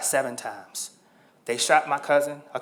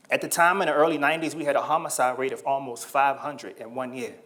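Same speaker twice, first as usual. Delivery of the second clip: ordinary talk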